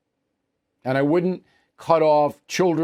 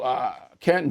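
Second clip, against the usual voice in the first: ordinary talk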